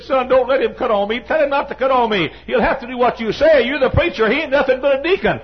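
very high, loud imitation of an old woman begging; hammed-up mother voice, half laughing; pleading old mother. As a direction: squeal it like a frail mother begging her preacher son. Mother voiced, scolding deacon.

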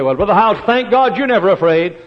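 a lightly voiced listener, high and loud; mock congregant. Imagined churchgoer teasing the preacher, high and loud. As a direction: toss it like a doubting churchgoer.

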